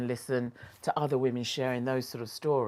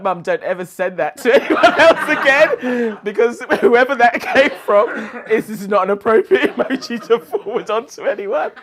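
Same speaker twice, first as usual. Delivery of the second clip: laughing scolding; 6 semitones higher, 15 dB louder than usual. Retold or imitated. Telling mum off about emojis.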